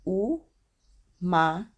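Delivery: umaga drawn out slowly, each syllable clear; slow Tagalog. Shown as a demonstration. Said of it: "slow Tagalog greeting demo".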